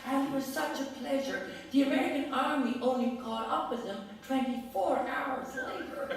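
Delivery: a hint of an elderly American lady's voice; gentle American accent recalling Sylvia Beach. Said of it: "in her American accent".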